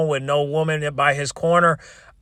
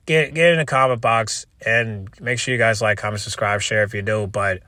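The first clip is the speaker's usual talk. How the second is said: ordinary talk, nothing marked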